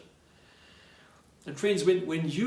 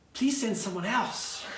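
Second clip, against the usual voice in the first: higher-pitched, imploring Moses; acted plea; 4 semitones higher than usual. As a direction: beg lightly to be let off. Moses voiced begging to be excused.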